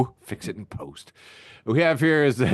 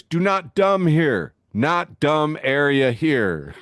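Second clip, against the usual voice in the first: slow broken English; 4 semitones higher, 4 dB louder, slower than usual. Retold or imitated. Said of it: "translated into English".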